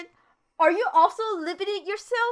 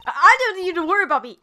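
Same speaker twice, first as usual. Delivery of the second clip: quick, higher, louder stammer; acted flustered rookie, pitched up; flustered protest; 4 semitones higher, 5 dB louder, much faster than usual. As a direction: rush out a defensive stammer. Rookie lawyer protesting in game.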